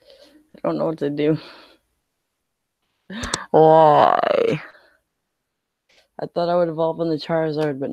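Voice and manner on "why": hammed-up crying yell of why; mock wail. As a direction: wail it to the sky in mock despair. Cries out at bad luck.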